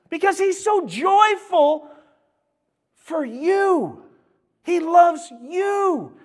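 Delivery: booming, slow preacher proclaiming love; full-force preaching. Acted. Pastor booming scripture to the congregation, full-force preaching. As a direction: preach it out loud and high to the whole church.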